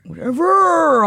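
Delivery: high, slow, exaggerated whatever; drawn-out mock whatever. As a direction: stretch out a dismissive whatever. Echoing the bored quote.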